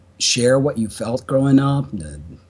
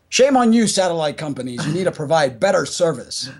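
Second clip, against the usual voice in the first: mock scolding shout; 5 semitones higher than usual. Scolding the satellite companies.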